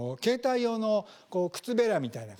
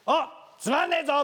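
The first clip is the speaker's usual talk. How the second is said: higher, louder heckle; heckler's yell; 8 semitones higher, 5 dB louder than usual. Retold or imitated. Audience member heckling the stage, loud jeer. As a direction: shout it from the back seats. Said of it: "客席からこう舞台に向かってね".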